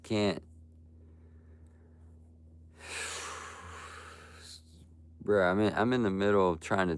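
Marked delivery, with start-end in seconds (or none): 2.9-3.9 long unvoiced exasperated sigh. Real, not acted. Streamer sighing at chat, fed up and unvoiced. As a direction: let out a tired, fed-up sigh.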